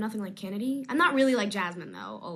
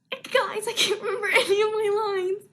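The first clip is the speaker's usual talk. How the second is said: laughing; 10 semitones higher, 6 dB louder than usual. Real, not acted. Blanking on lines, laughing.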